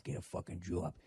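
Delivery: put-on low Boston-accent mutter. Office workers practising accents in cubicles, muttering. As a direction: mutter it under your breath. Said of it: "just muttering to yourself".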